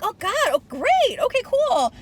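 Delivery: high, loud acted reaction; mock enthusiasm. Acted reaction to surprisingly big acts.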